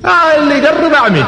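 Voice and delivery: high, loud warning; defiant shout; fierce threat. Retold or imitated. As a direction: dare them to come closer. Umar threatens the crowd.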